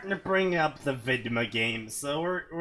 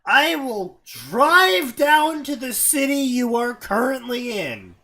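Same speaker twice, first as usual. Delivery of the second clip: mock threat; 4 semitones higher, 6 dB louder, slower than usual. Mock threat, slow and loud.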